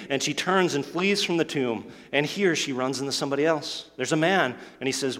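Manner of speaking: ordinary delivery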